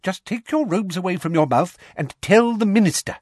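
high, insistent order; Umbridge, impatient. A narrator's reading. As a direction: impatiently urge a balky pupil. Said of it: "said Umbridge impatiently".

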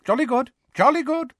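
high, jovial official; blustery, high-pitched heartiness; Fudge, hearty. A narrator's reading. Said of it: "said Fudge heartily".

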